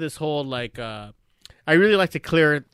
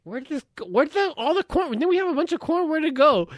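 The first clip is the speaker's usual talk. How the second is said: high-pitched, wondering questions; puzzled wife; 10 semitones higher than usual. Retold or imitated. Wife hunting for the corn to cook with, puzzled high voice. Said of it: "and then she's like".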